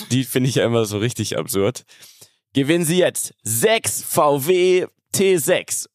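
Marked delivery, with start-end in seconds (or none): 2.5-5.9 slow, high game show announcer. Retold or imitated. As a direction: sell it like a TV prize show. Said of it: "kennt ihr diese Gewinnspiele im Fernsehen". (Fernsehwerbung-Sprecher)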